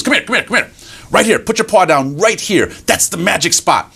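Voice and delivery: high, urgent beckoning; eager mouse. Acted. Mouse beckons fox onto the spot, eager. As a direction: coax fox over in a high voice. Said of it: "And mouse goes".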